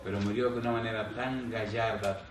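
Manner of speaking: ordinary delivery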